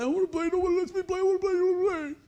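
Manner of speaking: put-on high, loud whining child's plea; a kid brother nagging to join the game; whiny little kid. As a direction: whine like a left-out little boy. Little brother begging to play.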